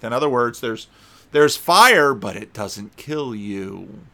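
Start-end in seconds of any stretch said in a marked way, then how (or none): 1.3-2.1 "fire" punched loud, then dropped; stress on fire. Real, not acted. Preacher punches key word. 2.1-4.0 softer, slow, dry aside; slow, quiet wry remark. Real, not acted.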